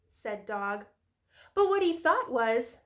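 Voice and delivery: usual speaking voice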